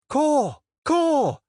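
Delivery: very high, loud crow calls; mocking caws. A narrator's reading. Kara heckling the friar again with crow calls. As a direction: caw at him to jeer.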